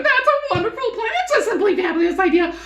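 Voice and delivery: very high, fast, loud gushing hens; excited giggling hens. Acted. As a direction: squeal with delight like vain hens. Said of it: "the hens began clucking to each other".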